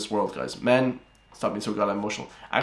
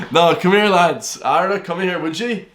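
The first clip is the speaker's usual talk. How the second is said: loud, higher country Irish accent; hammed-up Irish accent, pitched up; 5 semitones higher, 5 dB louder, slower than usual. Shown as a demonstration. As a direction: call the lads over in a broad Irish brogue. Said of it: "the flippin' Irish accent comes out in me".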